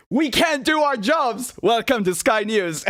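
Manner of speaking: high, drawn-out mock announcer plug; mocking slogan. Host parodying Sky News' plug, high drawn-out mockery.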